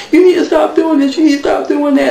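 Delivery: mock whiny Mimi pitched far up, with a laugh; fed-up Mimi. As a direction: imitate her pleading, high and exasperated. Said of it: "And she's like".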